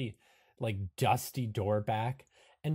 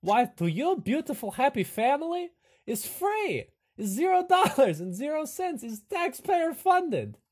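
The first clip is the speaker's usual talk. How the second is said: high, gleeful salesman voice; hammed-up, high-pitched host pitch; delighted camp host; 6 dB louder than usual. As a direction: sell it with mounting delight.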